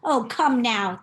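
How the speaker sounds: plain reading voice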